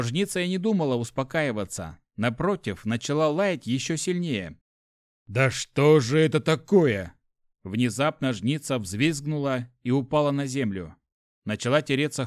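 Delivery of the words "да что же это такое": louder, stretched-out exasperated question; exasperated alarm. A narrator's reading. Lad at his frantic barking hyena, exasperated alarm. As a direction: demand an answer, loud and slow.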